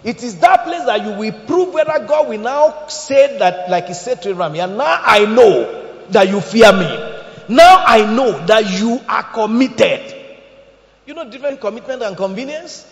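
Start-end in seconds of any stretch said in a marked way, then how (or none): 4.6-10.1 slow, loud, drawn-out proclamation; booming voice of God. Acted. God to Abraham, slow booming declaration. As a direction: thunder each word slowly like God speaking.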